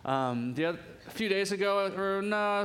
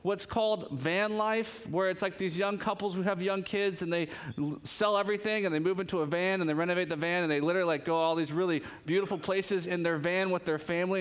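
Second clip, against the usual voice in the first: ordinary delivery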